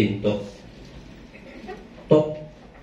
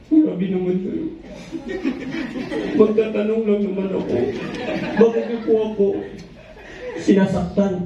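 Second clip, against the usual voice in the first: put-on whining child; 3 semitones higher than usual. Hurt child complaining to father.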